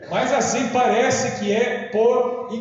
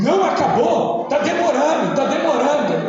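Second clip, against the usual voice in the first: high-pitched imitation of a complaining boy; whine-free high, loud mock child's protest; 8 semitones higher, 4 dB louder than usual. Small son complaining to his father during mass, loud. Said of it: "Ele falando alto assim".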